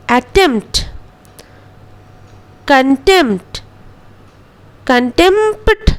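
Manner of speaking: अटेम्प्ट and कंटेम्प्ट with no p; silent p. Shown as a demonstration. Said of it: "पी का उच्चारण नहीं करेंगे". (पी silent रखकर)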